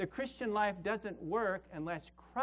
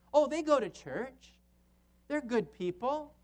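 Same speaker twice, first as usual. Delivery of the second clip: mock excuse; 5 semitones higher than usual. Mocking worldly church excuse.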